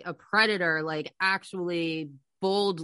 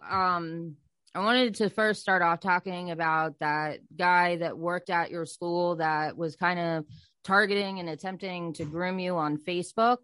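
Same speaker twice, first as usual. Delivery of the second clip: normal talking voice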